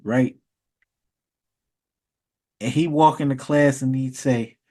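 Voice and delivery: normal talking voice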